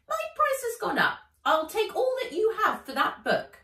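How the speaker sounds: high, loud squeaky elf voice; greedy elf. Acted. Elf haggling with Nat, high and loud. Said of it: "The elf cackled".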